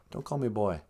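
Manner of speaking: usual speaking voice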